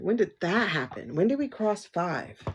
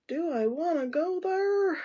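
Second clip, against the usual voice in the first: heightened mock hesitancy; 9 semitones higher than usual. Still torn over the mediumship stone, high mock hesitancy. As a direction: sing the doubt out, pitched high throughout. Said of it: "And I'm like".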